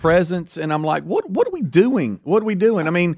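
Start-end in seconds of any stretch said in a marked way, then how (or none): 1.0-2.9 high-pitched, exasperated questioning; retold bafflement, pitched high; mock bewilderment. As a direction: question it in exaggerated disbelief. Retold bewilderment at lavish presents.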